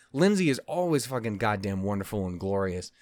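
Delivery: ordinary talk, nothing marked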